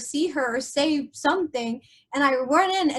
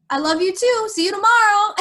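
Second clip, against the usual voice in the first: high, loud chirpy goodbye; cheery farewell; 7 semitones higher, 6 dB louder than usual. Retold or imitated. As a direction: put on an upbeat voice to say goodbye. Retelling upbeat farewell to dying loved one.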